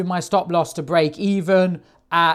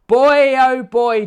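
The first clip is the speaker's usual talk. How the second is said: drawn-out high exclamation; boy oh boy; 7 semitones higher, 5 dB louder, slower than usual. Acted. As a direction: stretch it out, high and loud. Mocking defensive traders.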